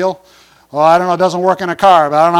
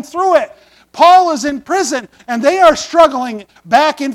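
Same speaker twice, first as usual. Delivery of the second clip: raised, ringing pulpit voice, pressing each point; preaching; 4 semitones higher, 5 dB louder than usual. Acted. Pastor preaching to his church in a raised voice. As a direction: preach it full out to the room.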